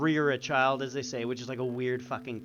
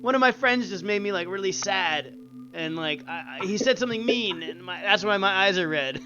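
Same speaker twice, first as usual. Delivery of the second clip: put-on teen excuse; 6 semitones higher, 3 dB louder than usual. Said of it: "I'm like".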